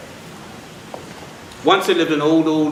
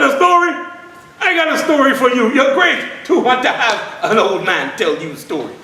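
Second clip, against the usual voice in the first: put-on delighted old man; 7 semitones higher than usual. Old father delighted the boy wants a story, high and laughing. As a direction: chuckle with joy at being asked.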